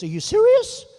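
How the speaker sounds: hammed-up disbelief at good news; mock astonishment. Ambassador hearing she got Japan, imitated loud surprise. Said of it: "She goes".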